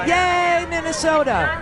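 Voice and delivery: high, loud sarcastic "yay"; sing-out fake hooray, high and drawn out; mock cheer. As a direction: cheer them on with heavy sarcasm.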